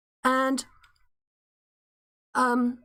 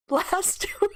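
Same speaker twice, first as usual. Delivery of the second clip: a laugh bursts out mid-sentence; can't hold the laugh in; breaking into laughter; 8 semitones higher than usual. Real, not acted. Reading the article, breaks up.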